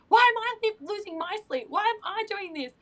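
high-pitched mock whine; frustrated whining. Tired mum complaining about lost sleep, mock whining. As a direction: whine it, imitating your own complaint.

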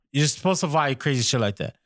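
ordinary delivery

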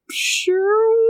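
high, questioning call for a missing friend; searching call. Retold or imitated. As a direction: call out hopefully, imitating a lost knight. Knight still calling hours later.

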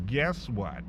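ordinary delivery

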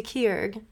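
Keurig squashed into one syllable, "keerg"; mispronounced Keurig. Real, not acted. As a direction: say Keurig as "keerg", dropping the second vowel. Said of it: "Wait, what did you call it?".

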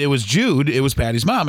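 ordinary delivery

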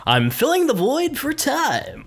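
high, slow grand announcer; welcome to Earth voice. Pretend. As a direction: boom it out like a cosmic greeter. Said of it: "that's my welcome to, to Earth voice".

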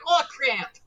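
high, mock-panicked yelp with a Minnesota accent; high-pitched Minnesota cry of alarm. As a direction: imitate a panicked Minnesotan in the background. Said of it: "Minnesota accent that's just so panicked".

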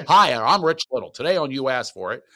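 put-on TV host introducing the show; Rich Little. Shown as a demonstration. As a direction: announce yourself in an impressionist's showy voice. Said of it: "that was one of my impressions".

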